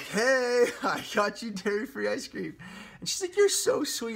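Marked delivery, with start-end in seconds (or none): 0.0-2.6 innocent greeting. Retold or imitated. Retells greeting girlfriend innocently. 3.4-4.2 imitating girlfriend. Imitates girlfriend's delighted reply.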